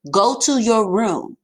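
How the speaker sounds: loud, slow parent command; stern command. Shown as a demonstration. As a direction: order them off to their room. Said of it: "is a command".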